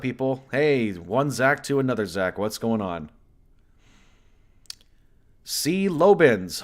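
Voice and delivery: ordinary talk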